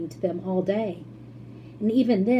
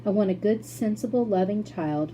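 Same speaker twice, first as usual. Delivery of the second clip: usual speaking voice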